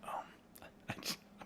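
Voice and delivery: soft breathy whisper; whispered aside. Real, not acted. Gamer whispers a wish to hear Picard weigh in. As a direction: say it under the breath.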